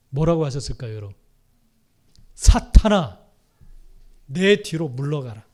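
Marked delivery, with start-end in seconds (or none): 2.3-5.5 loud sharp cry of 사탄아; stern rebuke. Acted. Jesus rebuking Peter who tried to stop him, loud. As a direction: cast him out with one loud word.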